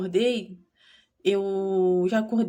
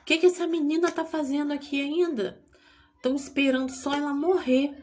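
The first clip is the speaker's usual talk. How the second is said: retold doctor, high and outraged; indignant doctor; 6 semitones higher than usual. Doctor scolding staff over the dying girl, high and indignant. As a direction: scoff at them like an angry doctor.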